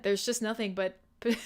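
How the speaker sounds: ordinary delivery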